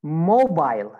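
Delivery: British "mobile", ending like "bile"; British accent. Shown as a demonstration. Teacher models British "mobile" for students, long I. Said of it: "In British accent".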